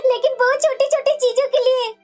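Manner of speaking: aggrieved lament with sobbing breaths; tearful complaint. Acted. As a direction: plead your case to your husband through tears. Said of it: "तुम्हारी आंखों में आंसू क्यों है". (सिसकते हुए दुखी स्वर में)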